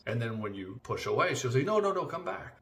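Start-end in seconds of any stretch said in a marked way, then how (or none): 1.6-2.6 much higher mock plea; high put-on pleading; mock pleading wife. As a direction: call him back, hammed-up. Mocking wife pulling back.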